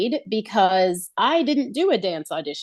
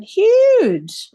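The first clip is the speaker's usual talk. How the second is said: emphatic huge; 13 semitones higher, 7 dB louder than usual. Real, not acted. To the hosts, gushing about Baz Luhrmann: an excited, loud Huge. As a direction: burst out the word Huge much higher and louder.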